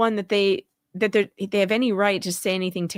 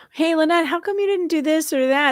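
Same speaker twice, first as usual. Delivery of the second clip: questioner voice; 9 semitones higher than usual. Retold or imitated. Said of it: "just someone with a".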